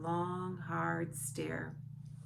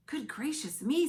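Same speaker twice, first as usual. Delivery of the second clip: breathy higher whispered wonder; amazed whisper; 8 semitones higher, 6 dB louder than usual. A narrator's reading. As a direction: Sophie awed, whispering. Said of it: "Sophie said".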